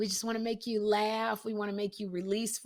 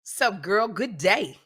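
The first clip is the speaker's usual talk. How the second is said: street greeting; 12 dB louder, much slower than usual. Retold or imitated. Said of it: "walks down the street and goes".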